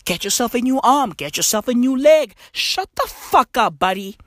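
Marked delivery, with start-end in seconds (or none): none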